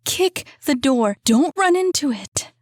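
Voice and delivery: high, loud urgent commands; desperate yelling. A narrator's reading. Woman yelling instructions to Jacob through the door, desperate shout. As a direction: bark the order before he hurts himself again. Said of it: "She was yelling now, desperate".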